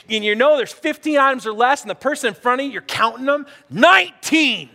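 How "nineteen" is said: loud, higher-pitched outrage; indignant. Acted. Shopper counting items in the express line, indignant. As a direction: call out the offence, slowly and loudly. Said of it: "your justice meter's going off".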